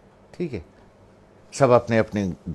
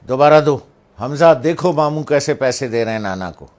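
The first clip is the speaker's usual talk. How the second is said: firm, loud order, higher; loud stern command; 5 semitones higher, 8 dB louder than usual. Retold or imitated. Mother loudly orders her son again, pointing out to grandson. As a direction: call it out so all can hear. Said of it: "جب امی نے کہا".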